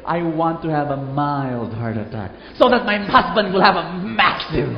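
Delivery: a hurt wife laughing at her revenge wish; laughing vengeful wish. Acted. Preacher voices vengeful wife.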